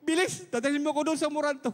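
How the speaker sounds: shouting to his friend the driver to rush him to Amoranto; retold shout. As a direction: yell for the driver to hurry. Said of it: "ako'y sumigaw sa aking driver".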